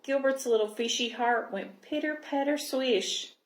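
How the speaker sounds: usual speaking voice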